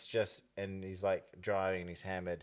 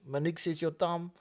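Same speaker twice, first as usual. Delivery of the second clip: put-on higher-pitched rural Māori accent; 6 semitones higher than usual. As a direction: mimic the ad's catchphrase with a rural Māori accent. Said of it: "the way I was talking right then".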